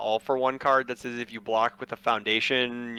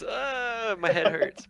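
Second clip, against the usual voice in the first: lightly whined mock complaint; a touch of pretend suffering; mock headache groan; 8 semitones higher, much slower than usual. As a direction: groan it as a put-on gripe. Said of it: "it's just like one of those".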